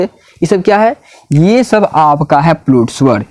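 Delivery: ordinary delivery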